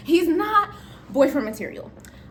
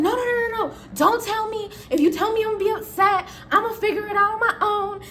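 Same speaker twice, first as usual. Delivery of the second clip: high-pitched insistent refusal; animated protest; 9 semitones higher than usual. Acted. Coworker begging not to be told the reason, high and insistent.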